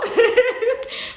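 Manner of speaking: loud, high burst of laughter; real hearty laugh. Real, not acted. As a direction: laugh at teasing her brother. Laughs about her brother.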